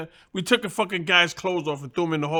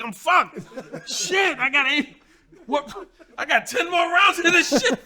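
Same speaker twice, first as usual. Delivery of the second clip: panicked inner voice; 9 semitones higher than usual. Retold or imitated. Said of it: "I'm like".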